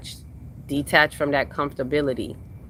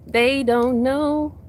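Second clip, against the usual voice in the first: they don't know, sung out high and slow; high drawn-out lament; 10 semitones higher, much slower than usual. Shown as a demonstration. Preacher to her live audience, high slow drawn-out lament. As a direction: stretch it high and slow like a sad refrain. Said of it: "They just don't know".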